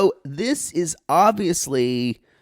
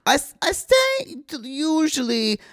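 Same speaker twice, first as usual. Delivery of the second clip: put-on high start, comic Eastern European accent; hesitant broken English, settling from high; cousin Olga; 5 semitones higher, slower than usual. Visiting cousin describing apartment visits, halting accented voice.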